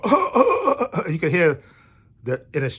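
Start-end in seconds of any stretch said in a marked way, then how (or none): 0.0-1.1 loud, high hacking laugh-cough imitation; imitated laughing cough. Imitating his sick friend trying to laugh, coughing laugh. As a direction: mimic a laugh breaking into coughs. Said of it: "he goes to laugh and go".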